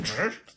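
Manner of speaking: high, loud imitated scratch noise; record scratch. Imagination abruptly stopped, imitated record scratch. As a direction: cut in with a vocal record scratch. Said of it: "And then record scratch".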